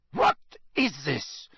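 put-on slow, drawn-out accented cry; cried out. A narrator's reading. Karkaroff seeing Krum on the ground, crying out. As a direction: cry out at what you find. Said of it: "he cried".

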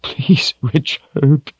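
high-pitched tearful begging; sobbing plea. A narrator's reading. Kahlan begs Richard through sobs, sobbing plea. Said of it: "she sobbed".

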